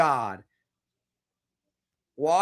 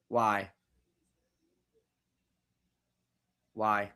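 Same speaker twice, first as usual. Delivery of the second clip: despairing why; 6 semitones lower, much slower than usual. Real, not acted. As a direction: let each why sink lower in despair. Fan despairing over his team.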